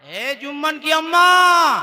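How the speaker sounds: husband hailing his wife, drawn out; long-held domestic holler; calling out. Acted. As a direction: call slowly to someone in a far room. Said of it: "और आवाज लगाया".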